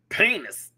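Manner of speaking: "penis" pitched high as PAY-niss; high, playful "penis"; comic pronunciation. Shown as a demonstration. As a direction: punch the AY in "penis". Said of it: "Oh, it's so funny".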